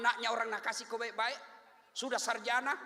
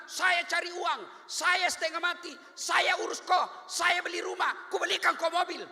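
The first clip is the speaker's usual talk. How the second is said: loud, higher, slow boasting shout; mock husband shouting; 7 semitones higher, 8 dB louder than usual. Preacher mocking a husband yelling his sacrifices at his wife. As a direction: bellow each line slowly at her.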